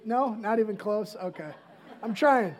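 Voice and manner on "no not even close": usual speaking voice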